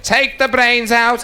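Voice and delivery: high, drawn-out kitchen call. Acted. Counter girl passes on the American's order, high drawn-out call. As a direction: call it through slowly on a high note.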